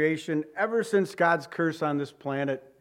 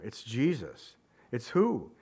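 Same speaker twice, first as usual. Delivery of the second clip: usual speaking voice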